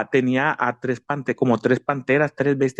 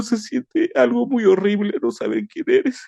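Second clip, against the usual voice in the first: crying to his wife; 9 semitones higher than usual. Retold or imitated.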